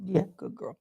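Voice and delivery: soft breathy praise to the puppy; whispered pet praise. Real, not acted. Whispering to her dog.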